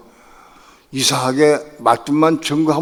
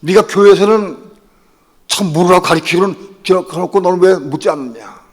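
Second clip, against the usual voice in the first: loud, higher voice of God scolding; God rebuking; 6 semitones higher, 9 dB louder than usual. Retold or imitated. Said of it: "책망하시더라고". (하나님이 꾸짖는 큰 목소리)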